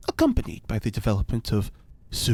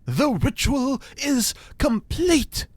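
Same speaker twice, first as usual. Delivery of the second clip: grand announcement; 13 semitones higher, slower than usual. Acted. Ritual completed, grand announcement.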